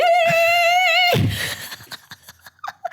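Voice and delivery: high shrieking laugh; squealing laugh. Real, not acted. Laughing after the call.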